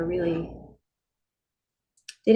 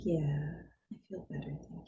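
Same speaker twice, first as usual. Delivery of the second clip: tiny hushed voice, nearly whispered; imitated calmed mother; 5 semitones lower, 13 dB quieter than usual. Mom thanking daughter after calming, whispering. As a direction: fade into a soft whisper.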